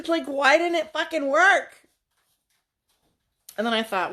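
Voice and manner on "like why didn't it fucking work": louder, higher frustrated outburst; exasperated. Real, not acted. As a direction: let the annoyance burst out laughing.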